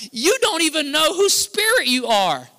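high, loud, sharp rebuke; Jesus scolding hard, voice up and loud; stern rebuke. Retold or imitated. Jesus rebuking his disciples, loud stern scolding. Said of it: "The spirit of slap comes on him".

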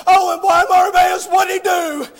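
normal talking voice

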